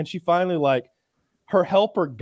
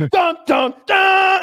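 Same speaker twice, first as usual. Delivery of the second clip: much higher, louder shouted dun dun dun; dramatic music sting; 7 dB louder, much slower than usual. Retold or imitated. Imitating the show's music sting, much higher and louder. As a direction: belt it like a TV reveal score. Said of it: "And the music goes".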